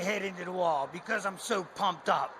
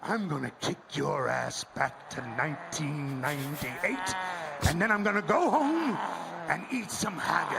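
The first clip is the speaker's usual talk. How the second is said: Scottish brogue; 6 semitones lower than usual. Acted. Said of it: "Scottish brogue".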